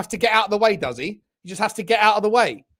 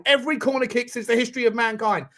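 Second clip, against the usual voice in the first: ordinary talk, nothing marked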